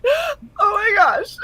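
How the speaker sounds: overwhelmed near tears; tearful oh my gosh. Retold or imitated. Patient overwhelmed by kindness, near-tearful high cry. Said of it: "'Cause I was just like".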